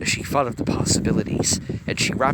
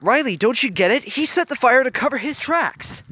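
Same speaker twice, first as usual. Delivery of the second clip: higher and louder, pushing the point; urgent, higher, louder realisation; 13 semitones higher, 7 dB louder than usual. A narrator's reading. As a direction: make her see it, urgently. Hiro's angry realisation.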